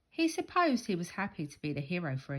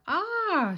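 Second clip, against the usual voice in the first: high, loud shocked scream; mum's startled high scream; 14 semitones higher, 7 dB louder than usual. A narrator's reading. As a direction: shriek at the ruined flower bed. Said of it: "she screamed".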